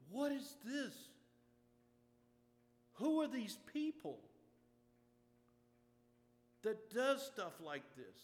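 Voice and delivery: high, loud, amazed and overwhelmed; amazed disbelief. Retold or imitated. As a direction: cry out in wonder at such kindness. Pastor recalls his amazed reaction.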